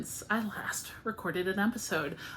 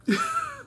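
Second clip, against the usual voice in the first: tired giggle. Real, not acted. Laughs after the yawn.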